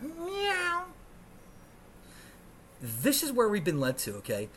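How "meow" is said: high-pitched imitation meow; mock kitty mew; cat meow. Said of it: "meowing like a cat".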